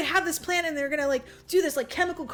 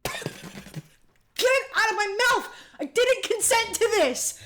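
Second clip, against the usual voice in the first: high-pitched comic monster outrage; mock indignant protest; 8 semitones higher than usual. Monster to those pumping stuff into his mouth, mock outrage.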